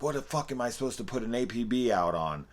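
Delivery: high-pitched furious yell at nobody in particular; angry yelling. Retold or imitated. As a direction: play the captain losing it at the office. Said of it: "yelling at random people".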